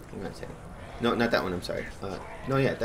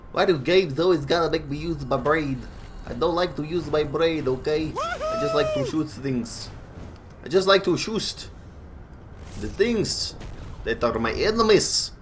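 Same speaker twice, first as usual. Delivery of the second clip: hammed-up Russian accent, grumbling; bad Russian accent; 3 semitones higher than usual. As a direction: put on a clumsy Russian accent. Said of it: "That was a bad Russian accent".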